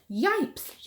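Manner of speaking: high startled yelp of Yipes; zebra yells. A narrator's reading. Zebra yelping Yipes at the sneezing elephant, high alarm. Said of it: "The zebra yelled".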